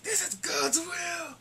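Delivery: high, slow, drawn-out zealot shout; fanatical priest cry. Retold or imitated. Priest laughing as he tortures her, high fanatical proclamation.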